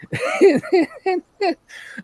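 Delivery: high, squealing laughter; real laughing fit. Real, not acted. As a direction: crack up helplessly at your own story. Cracking up at own story.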